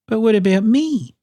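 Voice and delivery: high little bird voice; plaintive high-pitched question; Kiwi. A narrator's reading. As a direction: pipe up high, feeling left out. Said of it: "asked Kiwi".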